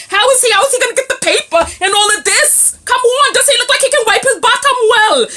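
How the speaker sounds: high, loud, rapid-fire disbelief; incredulous yelling. Real, not acted. Vlogger demands answers from viewers about a man, incredulous yell. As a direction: yell the questions as if it's unbelievable.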